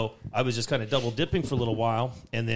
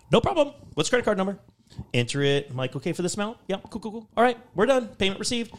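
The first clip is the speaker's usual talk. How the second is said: higher, overly bright customer-service voice; mock cheerful agent; 7 semitones higher than usual. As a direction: play the too-polite phone agent, pitched up. Sweet customer service voice retold.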